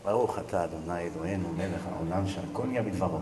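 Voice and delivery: low, soft monotone blessing; murmured blessing. Real, not acted. Rabbi says a blessing over his drink, quietly murmured. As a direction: murmur it privately, well under speaking voice.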